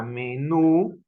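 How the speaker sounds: "menú" with a long, rising NÚ; stress on final syllable. Shown as a demonstration. Teacher showing where the voice is stronger, stressed "menú". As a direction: stretch and lift the last syllable of "menú". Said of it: "hay una intensidad mayor de voz".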